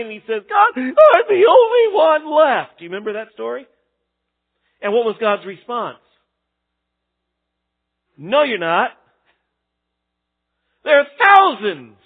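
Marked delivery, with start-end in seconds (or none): 0.5-2.8 much higher, louder anguished cry. Retold or imitated. Elijah crying to God, high anguished cry. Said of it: "and he's crying". 8.2-12.0 louder, rising to a high forceful "thousands"; emphatic divine reply. Retold or imitated. God answering Elijah, loud and firm.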